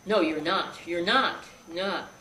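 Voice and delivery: firm loud insistence; loud denial. Retold or imitated. Grandfather telling the shot boy he's fine, loud denial. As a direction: deny it hard and fast.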